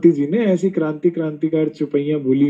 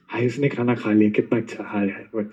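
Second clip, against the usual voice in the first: mock longing onlooker; 4 semitones lower than usual. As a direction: mimic someone drooling outside the window, hushed. Mimics onlooker watching others eat.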